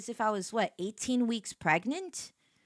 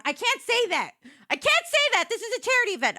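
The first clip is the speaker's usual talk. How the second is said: mock shock; 12 semitones higher than usual. Real, not acted. Catches own slip, laughing.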